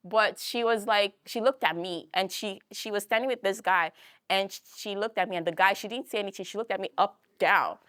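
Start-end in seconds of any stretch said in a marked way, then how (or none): none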